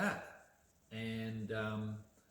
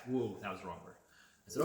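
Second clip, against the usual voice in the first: usual speaking voice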